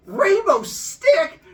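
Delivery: very high, loud, slow laughing cry; ecstatic discovery. Acted. Human finding the rainbow stick, high laughing squeal.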